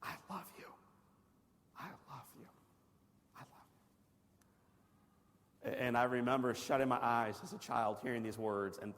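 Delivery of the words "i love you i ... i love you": whispered, softer each time until gone; fading whisper. Retold or imitated. Father whispering love to his burned son, fading. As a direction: breathe it out, fading to silence. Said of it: "my father says to me".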